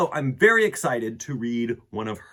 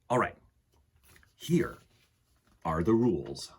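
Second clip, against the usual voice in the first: put-on gentle read-aloud to children; storybook reading; 3 semitones lower than usual. Reads picture book aloud.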